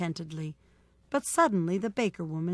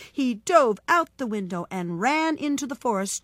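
plain reading voice

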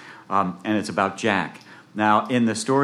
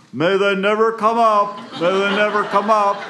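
yelling out; 11 semitones higher, 7 dB louder than usual. Acted. Jack calling to a farmer, high loud yell. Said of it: "Jack yells out to the farmer".

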